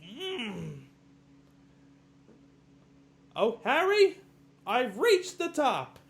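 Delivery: very high, loud, slow squeal of victory; hammed-up shrill falsetto; mock falsetto triumph. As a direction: shriek like a crook proud at the top. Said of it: "he's like".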